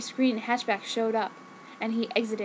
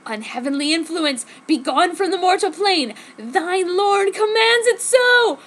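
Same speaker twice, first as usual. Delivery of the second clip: shouting; 6 semitones higher, 5 dB louder than usual. A narrator's reading. Priest exorcising the possessed boy, loud commanding shout. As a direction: order the demon out in a loud voice.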